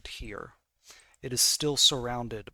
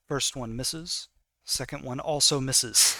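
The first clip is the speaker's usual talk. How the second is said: ordinary delivery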